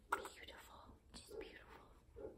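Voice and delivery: breathless whisper of adoration; hushed adoring whisper; awed whisper. Real, not acted. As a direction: barely breathe it, lost in love. Adoring the baby up close.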